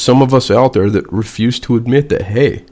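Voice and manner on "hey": normal talking voice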